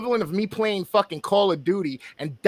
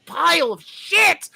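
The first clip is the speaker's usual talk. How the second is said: higher, fast-building angry rant, hammed up; retold furious rant; 10 semitones higher, slower than usual. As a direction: replay the meltdown, voice pitched up. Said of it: "I was irate".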